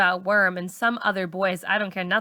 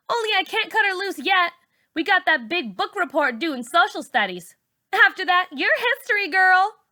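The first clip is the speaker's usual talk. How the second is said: much higher, mocking diva voice; cruel dismissal; 11 semitones higher, 3 dB louder than usual. A narrator's reading. Char tells the girls she'll drop Malika, high and mocking. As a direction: lord it over her.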